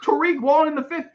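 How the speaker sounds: high, pushing disbelief at the team; exasperated rant. Real, not acted. Streamer berating the team for drafting another cornerback, high and exasperated. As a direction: argue with the team as if they can hear. Ranting at the team's pick.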